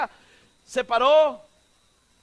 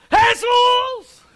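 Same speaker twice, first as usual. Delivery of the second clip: full-force high imitated yell; megaphone-loud shout; 10 semitones higher, 9 dB louder, much slower than usual. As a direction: bellow the name as if amplified. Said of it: "le subieron al megáfono al tipo".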